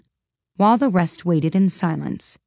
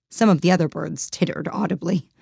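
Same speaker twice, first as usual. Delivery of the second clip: plain reading voice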